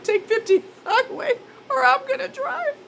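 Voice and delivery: very high, breaking with laughter; cracking up while quoting; laugh-crying repeat. Retold or imitated. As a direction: squeal the line through tears of laughter. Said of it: "repeated quote with laugh".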